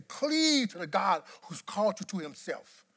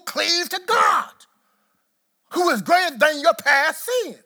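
preaching at full force; 5 semitones higher, 8 dB louder than usual. Acted. Pastor to congregation, shouting the climax. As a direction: preach it to the rafters, rising.

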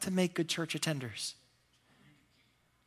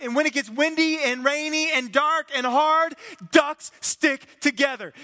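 much higher, loud, slowing to a rally cry; rousing rally cry; 9 semitones higher, 6 dB louder than usual. Retold or imitated. Team pumped in locker room, loud rousing rally cry. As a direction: build the crowd up, then land it.

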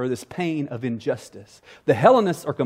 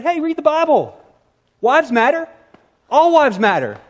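mock clueless husband, much higher and louder; tone-deaf husband; 10 semitones higher, 5 dB louder than usual. Husband brushing off his wife's complaint, mock clueless. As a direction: play the oblivious husband lecturing his wife. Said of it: "That would be a tone-deaf response".

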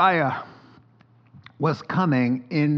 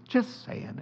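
whispered aside; 9 semitones higher, 6 dB quieter than usual. Real, not acted. Sly aside after point.

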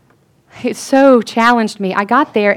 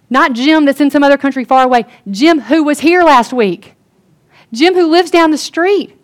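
louder, higher, urgent insistence; emphatic preaching; 6 semitones higher, 6 dB louder than usual. Real, not acted. Preacher pressing the congregation, loud and urgent. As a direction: drive home that he's close by. Stressing the prayed-for Jim is near.

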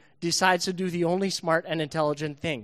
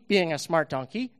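ordinary talk, nothing marked

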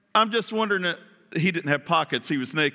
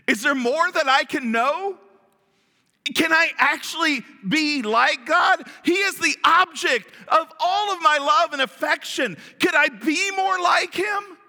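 higher and louder, rising to near shouts of longing; eager wondering; 7 semitones higher, 3 dB louder than usual. Pretend. Eve tempted by the serpent, calling out with eager longing. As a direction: voice Eve's growing hunger to be like God. Voicing Eve's temptation aloud.

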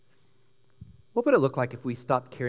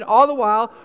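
ordinary talk

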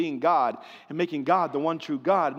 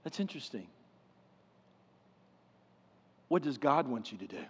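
soft, gentle question; gently and lovingly; 4 semitones lower, 7 dB quieter, faster than usual. Shown as a demonstration. Pastor showing the flock how to answer gently, softly. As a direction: ask it lovingly, quietly. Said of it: "to just gently and lovingly say".

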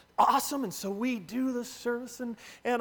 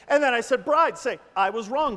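louder, energized retelling; excited build; 8 dB louder than usual. Retold or imitated. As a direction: push it up with excitement. Pastor's voice rising with excitement.

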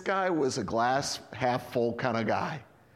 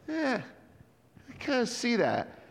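higher, softer, hesitant voice; a man not quite sure what he sees; lightly voiced doubtful blind man; unsure blind man; 7 semitones higher, 4 dB quieter than usual. Voicing the half-healed blind man.

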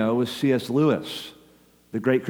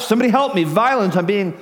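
calling out for help; 7 semitones higher than usual. Retold or imitated. Habakkuk voiced crying out to God while attacked, frantic high plea. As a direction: plead like someone under attack. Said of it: "It's like he's calling out".

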